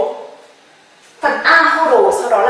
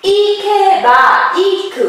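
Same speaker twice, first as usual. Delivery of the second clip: slow demo word; 6 semitones higher, slower than usual. Shown as a demonstration. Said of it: "Ví dụ".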